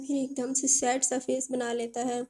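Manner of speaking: usual speaking voice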